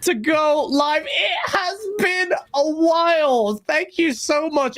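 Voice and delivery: show host intro. Acted. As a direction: announce the show warmly and grandly.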